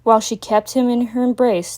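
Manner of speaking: plain reading voice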